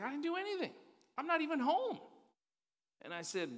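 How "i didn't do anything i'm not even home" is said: much higher, louder protest of innocence; indignant high-pitched husband protesting; defensive friend. Retold or imitated. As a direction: whine that it's not your fault. Friend defending himself to James.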